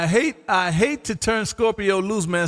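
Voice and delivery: usual speaking voice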